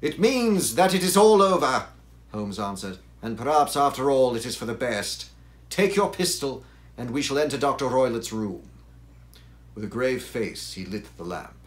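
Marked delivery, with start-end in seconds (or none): 0.0-1.9 loud, high-pitched proclamation; urgent answer. Acted. Holmes answers Watson after the cry, loud and urgent. 3.2-8.7 urgent orders. Acted. Holmes gives urgent orders.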